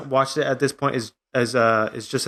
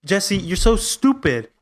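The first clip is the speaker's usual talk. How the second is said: higher, slowed, urgent pleading; pleading Walt; 4 semitones higher than usual. Retold or imitated. Friend acts out Walt pleading with Jesse, higher and urgent. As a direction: beg Jesse to see the trap. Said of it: "he's saying".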